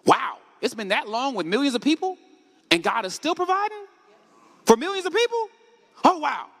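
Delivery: awed, grateful exclamation; amazed wonder. Retold or imitated. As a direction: marvel at it, climbing in pitch. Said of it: "From a godly perspective, you should say what?".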